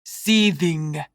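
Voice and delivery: "Seething" with each sound articulated; enunciated. A narrator's reading. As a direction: spell out "Seething" by careful enunciation. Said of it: "He enunciated every letter".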